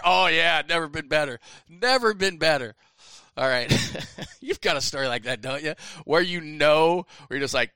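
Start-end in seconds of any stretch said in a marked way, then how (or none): 0.0-2.8 louder, put-on bravado; fake-cheerful reply. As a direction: call back pretending not to be hurt. Shouting back, hiding the injury.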